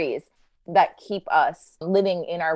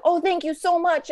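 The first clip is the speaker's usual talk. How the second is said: pitched much higher, bursting with thanks; a child's soul, overjoyed and grateful; retelling a storybook soul thrilled by the offer; excited; 11 semitones higher than usual. Retold or imitated. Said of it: "And he's so excited".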